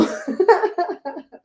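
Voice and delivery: high burst of real laughter. Real, not acted. Vlogger laughing at finding her own video.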